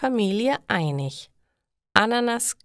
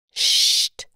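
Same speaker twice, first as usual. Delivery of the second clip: sharp shh for silence; shushing hiss; 5 dB louder than usual. A narrator's reading. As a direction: hush the newcomer. Shushing in meditation room.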